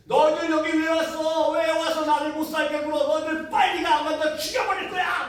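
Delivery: preacher retelling a possessed sister yelling at the team, menacing; possessed screaming. Retold or imitated. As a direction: voice the demon lashing out at the missionaries. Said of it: "막 소리 지르기 시작합니다".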